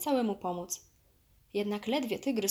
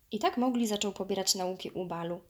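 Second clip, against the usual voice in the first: ordinary delivery, nothing marked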